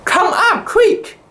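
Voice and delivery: high, urgent call down below; alarmed yell down the hatch; urgent shout. A narrator's reading. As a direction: shout for help, quick and sharp. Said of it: "I shouted down the cabin".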